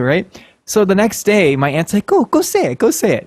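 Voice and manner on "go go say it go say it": high, urgent imitation of an aunt; egging on. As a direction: urge someone on in a high voice.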